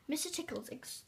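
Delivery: ordinary delivery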